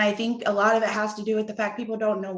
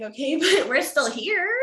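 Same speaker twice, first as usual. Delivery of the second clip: laughing; 6 semitones higher than usual. Retold or imitated. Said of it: "And I'm like".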